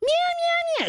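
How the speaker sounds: shrieking woman screech as meows; high-pitched screech. Retold or imitated. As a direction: screech it like a catfight. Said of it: "It's always a high-pitched screech".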